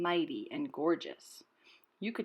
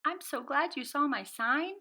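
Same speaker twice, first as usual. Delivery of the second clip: higher, louder chirpy voice; chirpy bird; 8 semitones higher, 5 dB louder than usual. A narrator's reading. Bird greeting Rocket at her sign, high and chirpy. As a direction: chirp it out brightly. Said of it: "the bird chirped".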